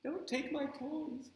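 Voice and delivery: high, soft, slow little plea; sad toy voice. Acted. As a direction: plead softly for the toy. Spencer voicing the sad bunny.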